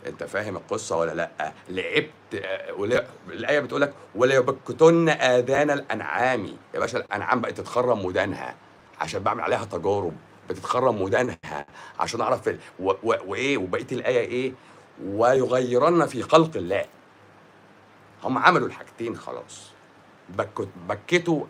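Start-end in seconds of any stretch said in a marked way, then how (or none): 4.1-6.4 recited verse. Acted. Quotes a Quranic verse. 15.0-16.8 recited verse. Acted. Quotes the rest of the verse.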